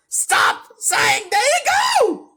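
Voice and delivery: high, loud cracking shout; strained yell. Acted. Yelling at the streamer to stop his catchphrase. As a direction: screech the command. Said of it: "I think I was meant to say".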